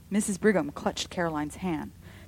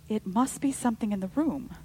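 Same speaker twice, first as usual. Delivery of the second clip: plain reading voice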